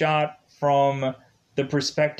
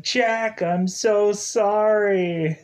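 anguished yell; 7 semitones higher, much slower than usual. Retold or imitated. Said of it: "he's yelling out".

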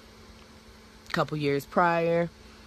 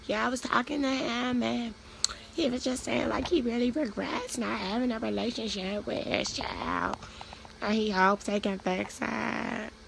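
higher, put-on gossip voice, slowing at the end; a lightly sweet, hushed gossip voice; the lady's voice; 4 semitones higher, 6 dB quieter than usual. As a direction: play the church lady passing on news. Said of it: "That's my voice".

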